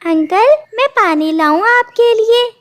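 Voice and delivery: put-on high, bright-eyed girl offering water; a sweet child eager to help; eager little girl. (उत्सुक छोटी बच्ची की ऊँची आवाज़)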